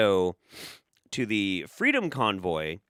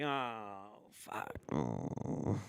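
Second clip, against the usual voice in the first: muttered dismay; 10 dB quieter, much slower than usual. Retold or imitated. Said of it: "I'm like".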